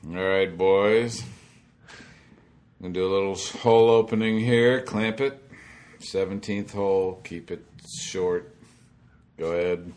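imitating a booming TV producer; lightly voiced boss, loud on the orders; Frank Chirkinian. Producer ordering a hole opening from the tower, imitated booming voice. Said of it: "Frank's booming voice comes through".

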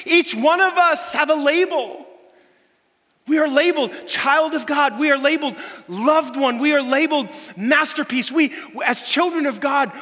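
loud, high impassioned sermon voice; preacher rallying the congregation, loud and high; full-force preaching. Acted. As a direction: preach it out to the back row.

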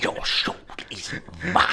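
sinister whispered villain echo; cold hissing layered whisper. Retold or imitated. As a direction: hiss it like a sorcerer. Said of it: "Shang Tsung".

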